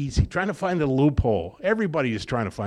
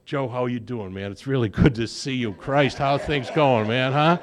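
put-on loud drunk greeting; 2 semitones higher, 7 dB louder than usual. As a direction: boom out hellos to the whole bar. Drunk greeting everyone loudly at bar.

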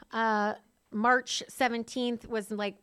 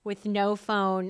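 ordinary delivery

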